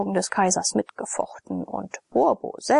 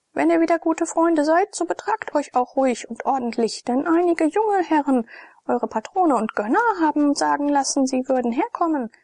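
higher, brisk commanding woman; bossy tavern wife; 6 semitones higher than usual. A narrator's reading. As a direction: lecture the gladiators like a strict hostess. Stratonike's long speech.

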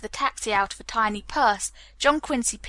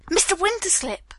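high, impatient summons; barked call; 8 semitones higher than usual. A narrator's reading. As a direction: call the name sharply, pitch well up. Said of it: "barked Hallett".

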